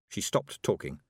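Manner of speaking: ordinary delivery, nothing marked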